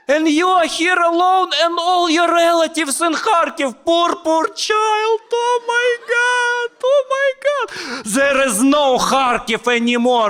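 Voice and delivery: very high, loud, wailing granny; a panicked old lady lamenting the city is gone; hysterical old lady. Retold or imitated. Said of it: "she was talking to me".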